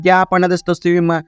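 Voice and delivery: usual speaking voice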